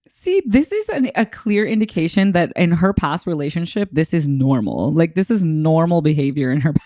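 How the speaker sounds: ordinary talk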